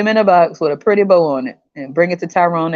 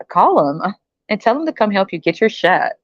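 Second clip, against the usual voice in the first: normal talking voice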